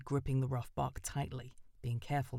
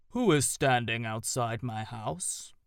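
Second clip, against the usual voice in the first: slow, louder, booming owl voice; deep organ-toned owl; 7 dB louder, slower than usual. A narrator's reading. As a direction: let it echo out of a hollow trunk like an organ. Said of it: "a deep, round voice, a voice like an organ tone".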